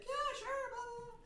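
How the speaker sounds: squeaky high imitation of the lady; mock chirpy woman. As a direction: pitch way up as a chirpy stranger. Lady voiced in story.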